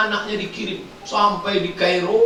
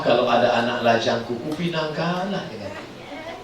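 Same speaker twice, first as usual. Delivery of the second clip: private wish; 7 semitones lower, 5 dB quieter than usual. Acted. Sick mother's private prayer, acted.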